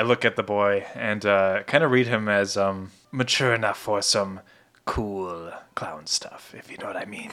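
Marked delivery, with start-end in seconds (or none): 4.8-7.3 conspiratorial whisper. Real, not acted. Whispered aside about the boy.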